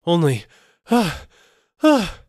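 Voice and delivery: very loud, very high gasping; terrified gasps. Acted. Terrified merchant breaks off into gasps before the seller. Terrified man can't finish.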